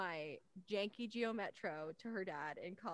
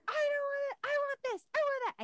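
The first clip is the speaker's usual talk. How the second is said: very high, loud mock tantrum; imitated kids screaming; 9 dB louder, faster than usual. Kids in the car demanding snacks, mock screaming. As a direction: screech demands like overexcited kids. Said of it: "the kids start screaming about it".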